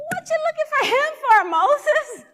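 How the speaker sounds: very high, loud, rapid disbelief; shocked outburst. Retold or imitated. Worker to Moses who is looking for God, shocked shriek. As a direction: shriek the question fast, as if appalled.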